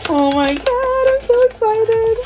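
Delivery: high squealed delight; excited squeal. Real, not acted. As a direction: squeal with real joy. Unboxing excitement.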